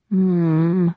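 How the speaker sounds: understated creepy phone breath. A narrator's reading. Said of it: "he breathed into the phone".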